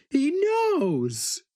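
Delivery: maniacal cry. A narrator's reading. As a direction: scream it like a man gone mad, not himself. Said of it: "in a voice too maniacal to be his own".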